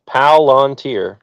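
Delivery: Palantir said slowly as three syllables, Pa-lan-tir; slow careful pronunciation. Shown as a demonstration. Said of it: "it's one word, three syllables".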